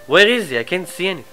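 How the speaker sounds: ordinary talk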